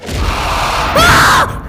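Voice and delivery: high startled yelp; startled scream. Real, not acted. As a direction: scream from a sudden scare. Jump scared by gorilla.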